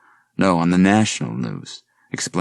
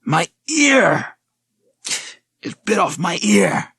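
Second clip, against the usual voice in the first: high, loud panicked shriek; shriek of pain; 13 semitones higher, 3 dB louder, slower than usual. Acted. Man attacked by a creature shrieks in pain and panic. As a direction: scream in horror at the wound. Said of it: "made Garrett shriek".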